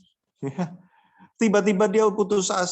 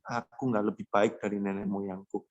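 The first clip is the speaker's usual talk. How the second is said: despairing mutter; 8 semitones lower, 5 dB quieter than usual. Acted. Elijah's despair voiced.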